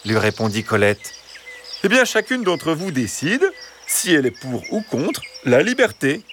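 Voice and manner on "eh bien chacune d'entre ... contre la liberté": high, smooth-talking fox voice; persuasive fox. Acted. Fox explaining the vote to the hens, persuasive. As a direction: explain it high, stressing no owner of the liberté.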